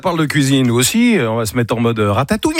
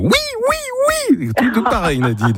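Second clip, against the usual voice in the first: much higher imitating voice, drawn out; Roselyne Bachelot impression; 10 semitones higher, slower than usual. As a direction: lightly mimic a politician's voice. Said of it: "Fais penser à Roselyne Bachelot".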